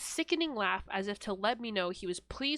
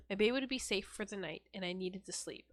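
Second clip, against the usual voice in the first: ordinary talk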